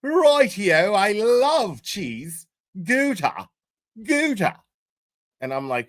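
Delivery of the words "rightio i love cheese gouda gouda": lightly mock English voice, slow on the cheese; mild mock English accent about cheese; imitating Cherry. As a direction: tease Cherry with a slight English lilt. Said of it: "Cherry's been like".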